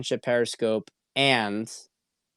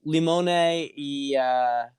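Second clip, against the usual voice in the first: put-on Jersey Italian accent; much slower than usual. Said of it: "I'll do my Jersey accent".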